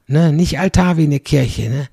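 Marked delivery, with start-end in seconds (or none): none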